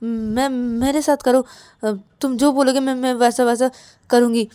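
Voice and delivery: stammering, repetitive begging; desperate pleading. Acted. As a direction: plead anxiously, tripping over every phrase. Ravi begs Rahul to stay. (घबराई, हकलाती हुई मिन्नतें)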